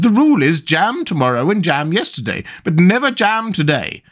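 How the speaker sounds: high, emphatic queen's voice. A narrator's reading. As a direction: state the rule in a high voice.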